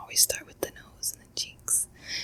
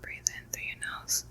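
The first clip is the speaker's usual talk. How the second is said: guided breathing; 3 semitones lower, 4 dB quieter than usual. Acted. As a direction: coax them through each breath, unhurried.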